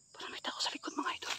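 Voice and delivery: hushed, tense whisper. Real, not acted. Telling viewers someone is behind, frightened whisper. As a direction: whisper so nothing behind hears.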